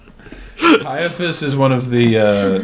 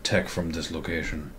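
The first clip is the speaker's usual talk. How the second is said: low, soft character impression; Peter Stormare; 7 semitones lower, 11 dB quieter than usual. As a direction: attempt a distinctive actor's voice, quietly. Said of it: "I can't do a good impression".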